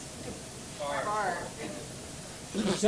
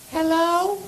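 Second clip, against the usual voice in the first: higher squeaky hello on the phone; 9 semitones higher, 4 dB louder than usual. Retold or imitated. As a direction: answer the phone in a high squeak. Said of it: "embarrassing on the telephone".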